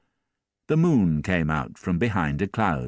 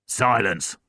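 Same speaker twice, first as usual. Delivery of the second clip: stern policeman; 4 semitones higher than usual. A narrator's reading. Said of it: "said one of the policemen".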